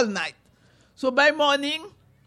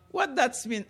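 plain reading voice

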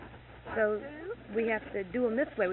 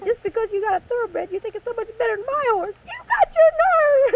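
loud, much higher, slowing at the end; furious yelling; 13 semitones higher, 13 dB louder than usual. Retold or imitated. Speaker yelling at her boss over his thoroughbred, furious. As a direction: yell through angry tears. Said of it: "I was so mad".

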